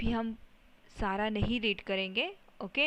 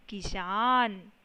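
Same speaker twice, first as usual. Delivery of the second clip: slow blended word; 4 dB louder, much slower than usual. Shown as a demonstration. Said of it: "क पर छोटी की मात्रा की".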